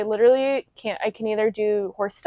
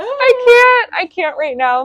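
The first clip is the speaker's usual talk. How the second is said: high, loud squeal easing down; overcome reaction; 11 semitones higher, 7 dB louder, slower than usual. Retold or imitated. Reacting to a muddy pair of designer shoes, overcome squeal. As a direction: squeal it, then settle back toward normal. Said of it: "I was like".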